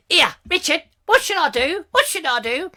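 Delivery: squeaky pleading voice. Pretend. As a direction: squeak it out like a fretful little character. Voicing a viewer's pleading question.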